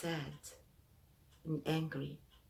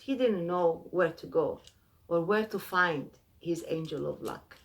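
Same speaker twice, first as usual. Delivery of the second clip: ordinary delivery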